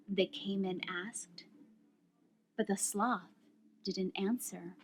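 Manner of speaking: unhurried picture-book reading, softly spoken; story read to children. A narrator's reading. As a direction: draw out each phrase gently, leaving long pauses.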